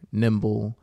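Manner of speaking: usual speaking voice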